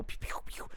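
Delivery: soft, toneless pew effects; imitated pew sounds. Moms swinging on each other, playful pew noises. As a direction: mouth little action-fight shots. Imitates mom action fight.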